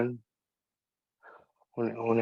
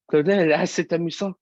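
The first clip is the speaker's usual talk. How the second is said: higher-pitched acted teacher reaction, louder at the start; mocking professor; 6 semitones higher, 4 dB louder than usual. Professor reacting to the student's wish to play Claudel, mocking amusement. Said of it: "c'est mon professeur qui parle".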